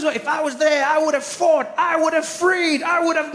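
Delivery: high, earnest mock pledge; imagined heroic vow. Imagined woman pledging she'd have fought, high and earnest. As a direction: vow it like a saviour. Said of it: "I thought she was".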